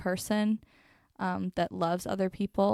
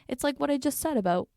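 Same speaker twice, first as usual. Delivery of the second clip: ordinary delivery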